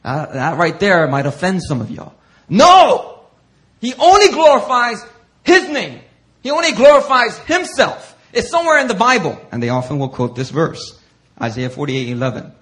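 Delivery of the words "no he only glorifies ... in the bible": much higher, louder outcry; mock objector shout. Preacher voicing critics who object, loud and high. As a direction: play the offended critic.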